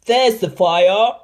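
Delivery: loud, slow shout; shouting firemen. A narrator's reading. Firemen spotting the fire, shouting. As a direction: call it out across the street. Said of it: "they shouted".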